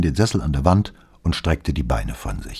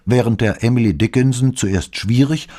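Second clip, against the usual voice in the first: ordinary delivery, nothing marked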